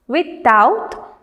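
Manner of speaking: "without" with the weight on OUT; stress on second syllable. Shown as a demonstration. Teacher reading words to students, "without" stressed on OUT. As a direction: say "without" as with-OUT, rising at the end. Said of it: "stress on the second syllable".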